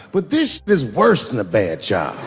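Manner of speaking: ordinary talk, nothing marked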